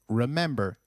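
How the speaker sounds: normal talking voice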